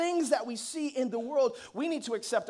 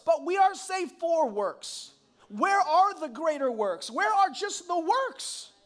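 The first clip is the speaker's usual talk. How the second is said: rising fervor; 6 semitones higher, 3 dB louder, slower than usual. Real, not acted. Preaching builds to high pitch.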